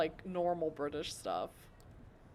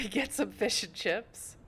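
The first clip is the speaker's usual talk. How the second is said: slow, hammed-up British accent; jokey put-on British; mock British accent; 3 semitones higher, slower than usual. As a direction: throw out a silly British phrase. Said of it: "I can do a better British accent".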